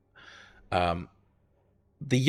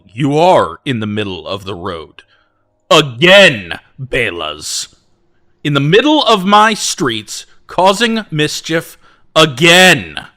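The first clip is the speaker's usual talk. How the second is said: booming lord mayor calling out; deep baritone call; 6 semitones higher, 9 dB louder, slower than usual. Acted. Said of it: "deep baritone voice call".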